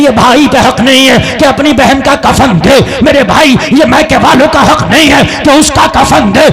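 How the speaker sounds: loud preaching exhortation; full-voice sermon admonition; preaching at full force. Acted. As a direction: call out to the brothers with force.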